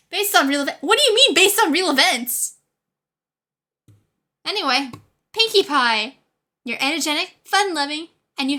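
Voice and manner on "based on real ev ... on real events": loud, high disbelief; what do you mean, louder and higher; incredulous. Real, not acted. Reacting to the quiz result.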